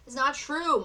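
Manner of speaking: put-on boy shouts his innocence; shouted. A narrator's reading. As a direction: call out the denial. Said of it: "Mike shouted".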